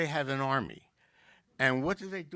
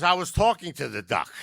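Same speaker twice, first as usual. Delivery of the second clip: punchy louder comeback; loud retort; 4 semitones higher, 10 dB louder than usual. Acted. Drunk snapping back at the lady, loud retort.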